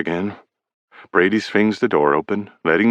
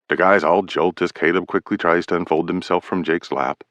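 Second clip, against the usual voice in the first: ordinary delivery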